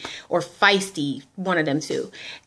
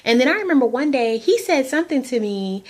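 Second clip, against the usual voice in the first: ordinary talk, nothing marked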